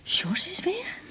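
hushed stunned question; shocked whisper. Acted. She hears Sjors has left Daan, whispered disbelief. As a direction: barely breathe the question out.

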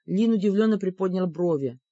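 ordinary delivery, nothing marked

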